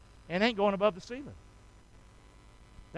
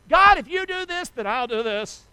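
demanding mock prayer; 6 semitones higher than usual. Preacher imitating people who dictate terms to God, mock demanding. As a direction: start high and pushy, bargaining with God. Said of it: "just the way you say".

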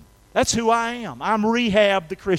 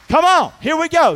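loud, high-pitched preacher's rally; rallying the crowd; 8 semitones higher, 12 dB louder than usual. Real, not acted. Preacher calls the congregation back on track, rallying loudly. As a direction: raise the voice high and rouse the room.

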